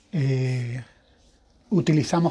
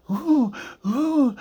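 high, whimpering imitation of desperate sobs; imitated sobbing; 9 semitones higher than usual. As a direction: mimic an old woman's inconsolable sobbing. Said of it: "eran unos sollozos". (imitación de sollozos desconsolados, agudos)

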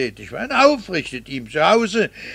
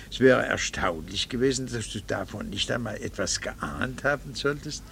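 usual speaking voice

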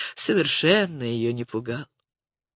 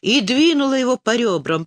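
usual speaking voice

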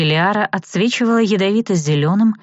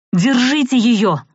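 raised, slow urgent cry of alarm; shout; 7 semitones higher, slower than usual. A narrator's reading. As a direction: call out to seize her. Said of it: "раздался запоздалый крик".

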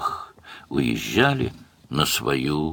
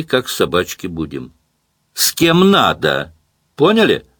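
menacing taunt; 8 semitones higher than usual. A narrator's reading. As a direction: warn them with sweet menace. Anya threatening the men.

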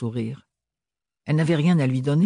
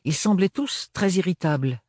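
plain reading voice

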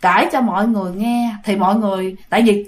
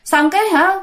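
ordinary talk, nothing marked

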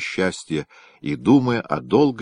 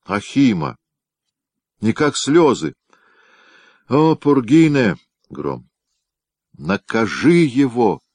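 slow, louder, higher lament to the gods; enraged outcry; 5 semitones higher, 4 dB louder than usual. A narrator's reading. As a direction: cry out in jealous fury. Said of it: "голос её стал похож более на шипение разгневанной орлицы". (гневный возглас разъярённой женщины)

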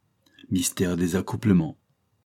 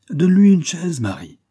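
put-on old master ordering; 10 semitones higher, 8 dB louder than usual.

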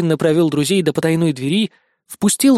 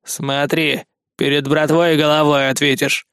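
threatening. A narrator's reading. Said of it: "пригрозил суровый страж".

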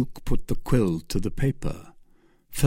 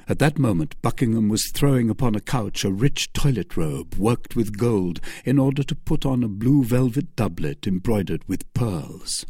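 plain reading voice

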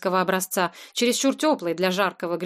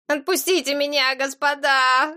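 put-on whimpering boy; 5 semitones higher than usual. A narrator's reading. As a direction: whine and beg like a small boy. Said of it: "захныкал мальчишка". (жалобное высокое хныканье)

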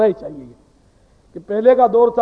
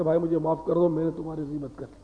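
humble apology; 4 semitones lower, 9 dB quieter, faster than usual. Retold or imitated.

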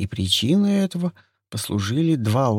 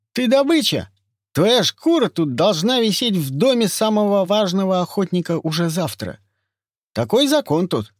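lisping voice; 7 semitones higher, 5 dB louder than usual. Acted.

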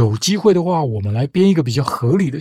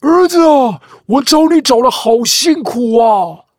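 high, loud, slow fatherly cry of relief; Trump as long-lost father; 7 semitones higher, 4 dB louder than usual. Acted. Trump hugging the boy, calling out to his lost son, hammed-up high and loud. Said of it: "把他抱起来说".

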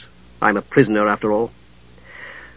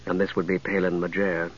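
low, cultured woman's voice; 7 semitones lower, 12 dB quieter than usual. A narrator's reading. Catherine speaks.

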